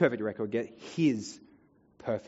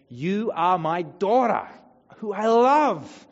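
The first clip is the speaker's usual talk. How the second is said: loving; 7 semitones higher, 10 dB louder, slower than usual. Pretend. God's voice blessing a child.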